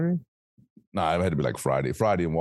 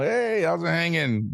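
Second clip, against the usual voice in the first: playful greeting; 9 semitones higher, slower than usual. Retold or imitated. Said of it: "Cause I do it all the time".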